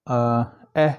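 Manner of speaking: अ and एह as isolated short vowel sounds. Shown as a demonstration. Teacher showing students short vowels, short अ and एह. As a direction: sound out अ, then a short open एह. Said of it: "ये short vowel है".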